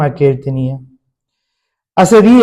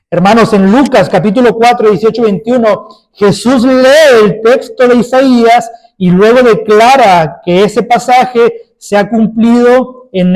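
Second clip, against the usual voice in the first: fervent preaching, pushed up and louder; 4 semitones higher, 4 dB louder than usual. Acted. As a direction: preach it out to the congregation. Preaching with rising force. (predicación con fervor)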